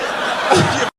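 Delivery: shouted burst of laughter; loud cackle. Real, not acted. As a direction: crack up loudly. Loud laugh after punchline.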